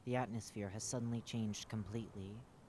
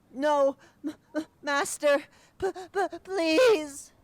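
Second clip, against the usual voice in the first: very high, loud sobbing plea; crying creature; 16 dB louder, slower than usual. Acted. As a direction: sob out please. Creature cries please.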